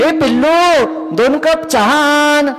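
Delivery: high, drawn-out sing-out order; mock doting husband. Husband on the sofa asks wife for tea, mock doting. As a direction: sing out a pet-name request for tea. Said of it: "साहेबांना विनंती केली".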